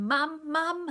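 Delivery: put-on high baby chant; babbling baby. A narrator's reading. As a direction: pipe the two words up high. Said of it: "our funny baby was saying".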